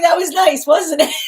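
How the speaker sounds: loud, laughing mock-smug question; laughing tease. Laughing as she quotes the guide's smug question. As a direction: crack up while teasing. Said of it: "they're going".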